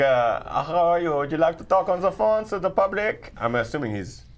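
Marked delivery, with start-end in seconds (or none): 0.0-3.3 put-on a loose Swedish German accent; sing-song Swedish German accent, a bit louder. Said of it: "Swedish German".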